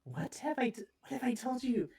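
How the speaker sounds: hushed, high scolding whisper. Acted. Boy whispering a scolding to his squeak. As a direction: hiss it at the squeak like a secret scold. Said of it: "The boy begins to speak".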